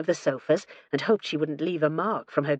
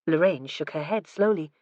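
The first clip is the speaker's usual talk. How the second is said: usual speaking voice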